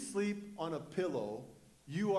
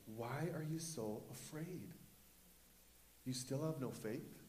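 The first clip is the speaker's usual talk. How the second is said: low, soft, searching question; Jesus gently questioning; 3 semitones lower, 9 dB quieter than usual. Acted. Jesus to his frightened disciples, soft low questioning. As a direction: ask it quietly, without alarm. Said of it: "He said to his disciples".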